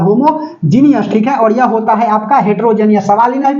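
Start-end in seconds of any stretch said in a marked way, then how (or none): 0.0-1.1 homogeneous said slowly, each syllable drawn out; slow careful word. Shown as a demonstration. Teacher drilling the class on homogeneous, slow and deliberate. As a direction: spell out homogeneous slowly so the class can copy it. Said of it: "homogeneous बोला जाता है".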